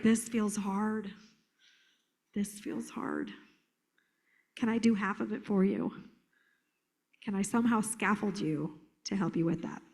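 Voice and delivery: meet them softly where they are; gentle empathy. Acted. Trainer modelling an answer to a struggling child, gently empathetic. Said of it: "I have to be like".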